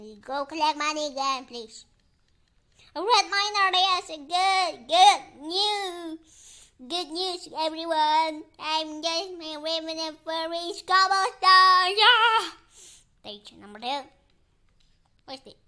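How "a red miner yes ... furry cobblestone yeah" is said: loud, high, thrilled shouting; a louder, higher burst of game-win excitement; excited cheering. Real, not acted. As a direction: cheer it like you just won big.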